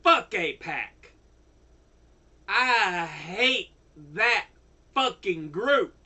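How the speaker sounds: normal talking voice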